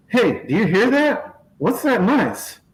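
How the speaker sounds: high, excited mimicry of a clueless farmer; giddy mock of the hired man. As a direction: ham up the eager guy straining to hear. Mocking the guy hearing a tractor.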